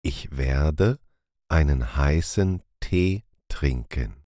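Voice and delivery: usual speaking voice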